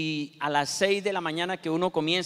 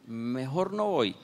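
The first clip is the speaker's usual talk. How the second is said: calm resignation; 5 semitones lower, slower than usual. Shown as a demonstration. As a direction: say it calmly, giving up the trip. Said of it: "se ponga calmadito y diga".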